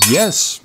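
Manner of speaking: sudden loud burst of laughter; surprised laugh. Acted. Tutor's strike is blocked by the princess, bursting laugh. Deflected mid-attack.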